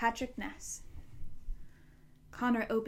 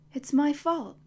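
anguished; 6 semitones higher, 7 dB louder than usual. A narrator's reading. Connor's anguished repetition.

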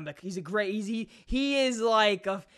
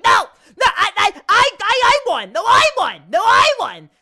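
much higher, shouted cartoon crybaby; screaming cartoon baby refusing to lose; Big Baby tantrum; 13 semitones higher, 13 dB louder than usual. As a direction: throw a shrieking toddler fit, pacifier in mouth. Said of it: "the cartoon character, Big Baby".

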